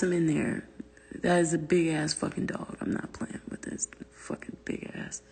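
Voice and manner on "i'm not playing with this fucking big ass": whisper. Real, not acted. Muttered aside about big dog.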